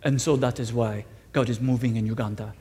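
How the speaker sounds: imitating the guest's deep voice as it sounded in my mind; Richmond's deep voice. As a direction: sink into a booming deep preacher's voice, quietly. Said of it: "this is how it sounded".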